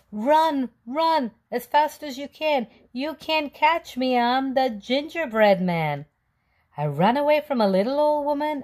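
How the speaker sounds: high, loud singsong taunt; taunting yell. Acted. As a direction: tease the chasers with a chant. Cookie taunting again.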